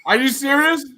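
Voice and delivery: high-pitched, incredulous demand. Real, not acted. Fan reacting to a missed kick, incredulous outburst. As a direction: voice jumps up in disbelief.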